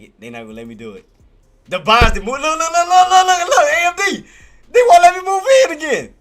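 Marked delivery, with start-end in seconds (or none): 1.7-6.1 high-pitched, loud exasperated outcry; loud protest. Real, not acted. Trader shut out by moving buyers, loud high protest. Protests being blocked from entry.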